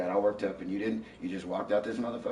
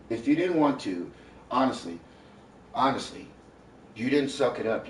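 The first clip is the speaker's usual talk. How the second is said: loud, raised scolding voice; angry scolding; 4 semitones higher, 6 dB louder than usual. Real, not acted. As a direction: lay into her. Heated argument with partner.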